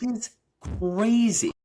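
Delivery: high, loud crazy; excited. Real, not acted. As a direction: exclaim it.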